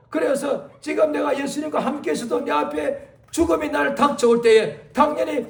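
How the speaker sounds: ordinary delivery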